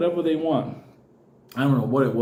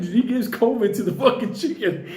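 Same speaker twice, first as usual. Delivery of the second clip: ordinary talk, nothing marked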